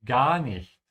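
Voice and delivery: long, wide-open A in "Gar"; stretched A. Shown as a demonstration. Teacher showing students how to sound natural, long A in "Gar". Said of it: "stretch the A".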